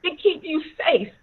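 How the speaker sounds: high, loud insistent repetition; angry yelling. Retold or imitated. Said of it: "my dad started yelling again".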